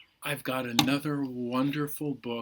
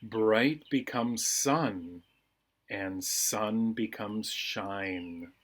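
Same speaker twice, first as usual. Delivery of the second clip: slow, soothing read-aloud; slow bedtime reading; slower than usual. A narrator's reading. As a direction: lull them toward sleep, unhurried. Reads calming bedtime book.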